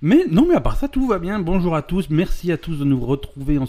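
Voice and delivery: high, loud cheerful relaunch; big upbeat restart; excited. Real, not acted.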